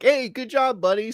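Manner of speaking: high-pitched acted-out pat on the back; chummy praise. Acting out praising a friend with a pat, high and chummy.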